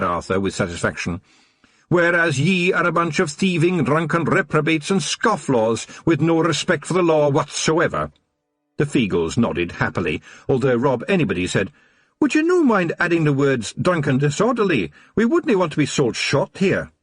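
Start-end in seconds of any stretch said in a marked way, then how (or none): 1.9-8.1 high Scots voice, gloating; a wee copper who caught them red-handed; smug Scottish watchman. A narrator's reading. Wee Mad Arthur to the Feagles, gloating Scots voice. 12.2-16.9 high, teasing Scots brogue; amused Scottish pixie. A narrator's reading. Rob Anybody teasing Wee Mad Arthur, high amused Scots. Rob asks to add words.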